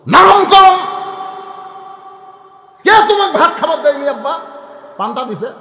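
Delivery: high, loud, fast demand; boy shouting. Acted. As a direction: fire the questions like a furious child. Boy keeps shouting at father. (চড়া গলায় চিৎকার)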